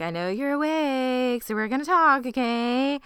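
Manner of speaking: high singsong parent talk; acted mom voice. Mother goes into son's room, singsong mom voice. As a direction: coax a child in a put-on mom voice.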